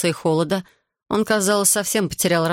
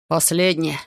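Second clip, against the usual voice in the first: ordinary delivery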